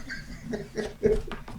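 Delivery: high, breathless giggling; laughing. Real, not acted. Host cracking up at the accidental accent, giggling. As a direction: crack up at your own silliness.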